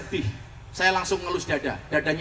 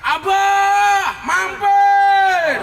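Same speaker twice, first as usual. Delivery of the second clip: put-on high, loud call of street girls beckoning; high loud mock call from the roadside; calling out teasingly; 11 semitones higher, 6 dB louder, much slower than usual. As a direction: shout it up high like women hailing a car. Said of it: "mereka teriak".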